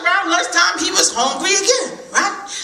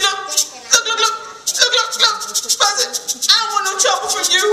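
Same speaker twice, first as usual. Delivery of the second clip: higher, rushed and jittery; nervous squirrel; 6 semitones higher than usual. Acted. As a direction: chatter anxiously like a skittish squirrel.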